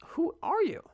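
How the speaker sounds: very high, squeaked indignation; mock disbelief. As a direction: squeak it in hammed-up disbelief at the cat. Mock scolding of the cat.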